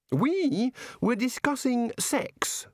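a touch of eager delight; enthusiastic. A narrator's reading. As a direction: say it like it's thrilling news. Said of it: "said the god enthusiastically".

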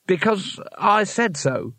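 high, slow, lamely bossy; insist, out of answers; flustered insistence. Acted. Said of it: "best she could manage".

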